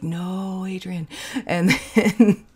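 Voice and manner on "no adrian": hushed scold. Retold or imitated. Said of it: "My advisor's just like".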